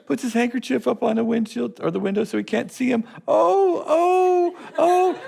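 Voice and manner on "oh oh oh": high, whimpering oh oh, hammed up; a scared little man cowering in his car; mock frightened whimper. Timid driver cowering, retold.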